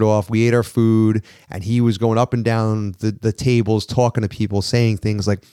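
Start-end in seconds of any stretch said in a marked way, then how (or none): none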